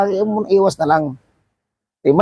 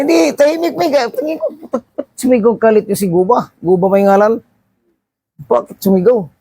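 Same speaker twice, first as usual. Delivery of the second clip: ordinary delivery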